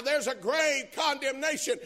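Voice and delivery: loud, high shout of grave condemnation; shouted point. Acted. Preacher warning sinners, shouting the point high. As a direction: hammer it home at full voice.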